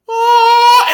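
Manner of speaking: loud, very high "oh"; drawn-out mock cry. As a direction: imitate a long high wail. Imitating moment Night King turns.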